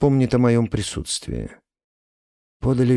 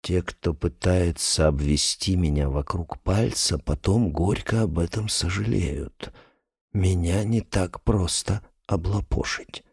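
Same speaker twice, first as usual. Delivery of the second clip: low, slow, menacing ruler; quiet cold threat from a powerful man; sinister voice; 4 semitones lower, 5 dB quieter, slower than usual. A narrator's reading. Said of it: "произнёс зловещим голосом".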